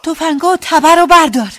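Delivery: high, loud urgent command; shouting. A narrator's reading. Daughter ordering her stunned father to grab the guns, shouted. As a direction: yell the order across to someone. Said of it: "رو به پدرم فریاد زدم".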